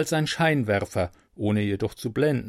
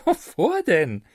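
higher, slow chuckling question; 4 dB louder, slower than usual. Acted. Narrator chuckling at the angel's warning. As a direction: chuckle it off fearlessly. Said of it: "gluckste ich".

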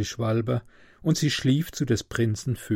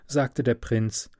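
ordinary delivery, nothing marked

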